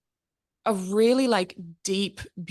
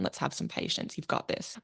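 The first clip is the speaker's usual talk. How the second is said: whispered, much softer and lower; soft reassurance; 4 semitones lower, 8 dB quieter than usual. Acted. Telling a scared friend to be patient, soft whisper. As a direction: gently whisper comfort to yourself.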